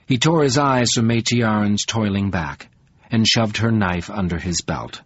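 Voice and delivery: plain reading voice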